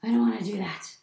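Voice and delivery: loud storybook protest; mildly hammed-up tantrum; flipped-out refusal. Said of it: "he flipped out. He was like".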